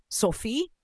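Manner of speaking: desperate plea, pitch climbing on the questions; halting, rising, anguished questions; suddenly human, desperate. A narrator's reading. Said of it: "plötzlich menschlich und verzweifelt".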